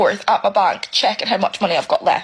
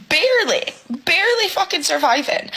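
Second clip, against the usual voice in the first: exasperated; 8 semitones higher than usual. Real, not acted. Emotional single parent on bills.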